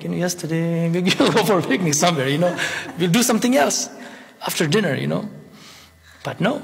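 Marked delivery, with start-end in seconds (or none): none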